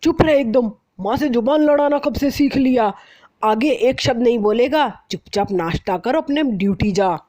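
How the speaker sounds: father scolding his son for talking back. Acted. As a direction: shut your son up at once. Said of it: "डांटते हुए कहते हैं".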